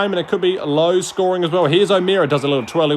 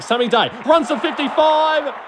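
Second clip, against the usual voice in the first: excited call; 8 semitones higher than usual. Acted. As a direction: shout the run like live play-by-play. Commentator calls a fast break.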